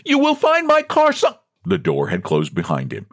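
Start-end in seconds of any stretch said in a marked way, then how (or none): 0.0-1.4 very high, frantic cry, cut off; frantic high-pitched plea; desperate last attempt. A narrator's reading. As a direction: call out desperately until cut short. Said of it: "desperately tried to add".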